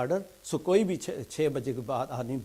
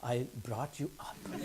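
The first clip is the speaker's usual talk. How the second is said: laughing through punchline; 3 dB louder, much slower than usual. Retold or imitated. Punchline to the lift boy.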